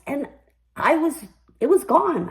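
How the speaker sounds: usual speaking voice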